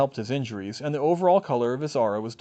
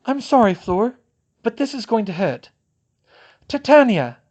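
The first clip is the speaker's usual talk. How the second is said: firm apologetic; 9 semitones higher, 8 dB louder than usual. A narrator's reading. Hermione warning then casting.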